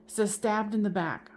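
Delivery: usual speaking voice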